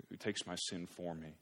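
ordinary talk, nothing marked

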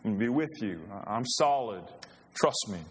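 cocky friend brushing off the warning; Peter piping up. Acted. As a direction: Peter boasting to Jesus that he'll stand firm. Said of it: "he pipes up and says".

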